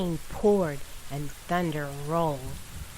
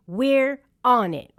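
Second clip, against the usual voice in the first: confident call; 6 semitones higher, 7 dB louder, slower than usual. A narrator's reading. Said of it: "Ryder replied".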